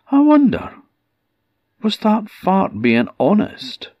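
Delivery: high, curious wondering voice; doubtful and curious; woman wonders to herself, high and curious. Acted.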